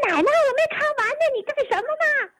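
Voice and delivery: put-on high, upset child's cry of protest; child yelling at grandma. Grandson protests to grandma, high child's yell. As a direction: play the kid whose cartoon got cut off. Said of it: "就跟我那个老李大姐就喊".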